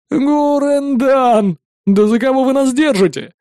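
high, drawn-out mock battle cry; squealing outburst. A narrator's reading. Friend mocking the grand love advice, squealing. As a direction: squeal it out, stretching the opening name. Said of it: "заверещал Бальник и дико заржал".

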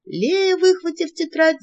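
ordinary delivery

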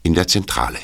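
calm storyteller's read over music; radio play narrator setting the scene; story narration. A narrator's reading. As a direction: narrate the tale to the listeners. Narrator introduces the search.

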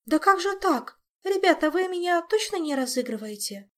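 high, incredulous protest; dismayed disbelief. Acted. Olga asking friends if this is a prank, high and disbelieving. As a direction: question them in rising alarm.

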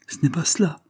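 high, urgent protest from the daughter; crying out. A narrator's reading. Daughter cries out to the doctors, high and urgent. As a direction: cry it out high and insistent. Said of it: "s'écria la jeune fille".

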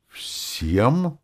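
high, appalled question; horrified squeak of disbelief. A narrator's reading. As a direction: ask it aghast, pitch shooting up. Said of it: "в ужасе вспыхнула Роза".

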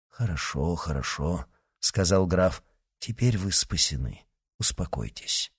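soft, low and calming; reassuring. A narrator's reading. Athos calming the rescued Mordaunt, soft and low. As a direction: soothe him quietly.

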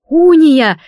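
loud startled cry of a name; sudden yell at a friend. Acted. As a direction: shout her name in alarm. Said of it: "завопила я".

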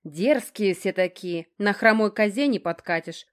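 ordinary delivery, nothing marked

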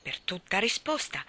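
usual speaking voice

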